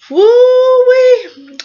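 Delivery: loud, high drawn-out woo-wee; exclaimed whoop. Real, not acted. Reviewer reacting to the boss lady, loud high whoop. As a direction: let out a long high whoop.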